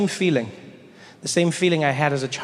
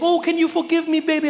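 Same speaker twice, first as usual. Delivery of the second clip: very high, begging mother voice; hammed-up apology, cooing to the child; pleading mom; 13 semitones higher than usual. Mom begging her forgotten kid, mock pleading. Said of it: "she'd be like".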